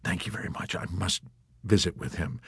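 very soft, hushed murmur; whispered. A narrator's reading. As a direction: keep it hushed and timid. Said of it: "the chaplain whispered".

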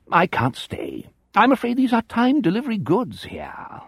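high, plummy upper-class English stage voice; bogus English aristocrat. A narrator's reading. Malshun mocking the visitors, hammed-up posh English. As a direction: ham up a fifties West End toff in posh English. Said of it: "a bogus English aristocrat in a West End stage comedy".

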